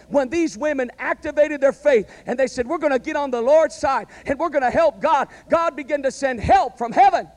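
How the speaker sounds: loud, driving sermon climax; full-force preaching. Acted. Preacher rousing the congregation, loud and driving. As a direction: preach it to the rafters.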